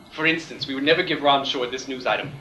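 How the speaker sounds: ordinary talk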